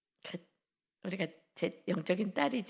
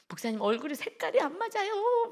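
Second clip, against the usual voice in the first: quoted complaint pitched way up; high young woman voice; 9 semitones higher than usual. Retold or imitated. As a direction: fuss over his face color, high. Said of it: "막 이러면서". (높은 자매 목소리로 투정)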